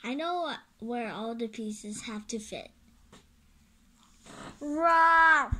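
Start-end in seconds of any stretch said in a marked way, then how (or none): 4.6-5.5 loud high war cry; battle roar. Acted. Hero charging into battle with a loud roar. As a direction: roar into the fight.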